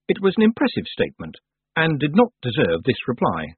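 plain reading voice

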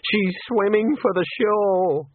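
high, slow, plaintive moan; moaning dismay. A narrator's reading. Senior wrangler sees housekeeper swimming off, plaintive moan. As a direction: moan it out, drawn long. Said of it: "the senior wrangler moaned".

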